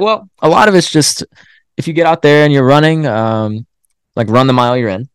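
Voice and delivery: imitating Brian. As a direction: lightly voice the calm mentor. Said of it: "He was like".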